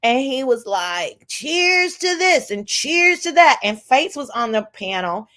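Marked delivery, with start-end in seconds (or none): 1.3-3.6 higher, slow mock-drunk toast; playing drunk. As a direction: draw out a tipsy toast, imitating. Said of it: "Triple was playing drunk".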